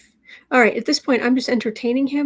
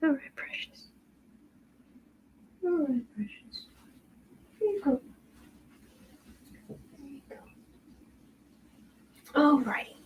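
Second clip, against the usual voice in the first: faint whisper to a dozing dog; whispered pet talk; 3 semitones higher, 18 dB quieter than usual. Real, not acted. Owner whispering so the dog keeps resting, whisper. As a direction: barely breathe the words.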